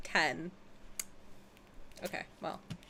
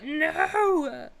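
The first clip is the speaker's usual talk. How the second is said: dismayed cry; 5 semitones higher than usual. Real, not acted.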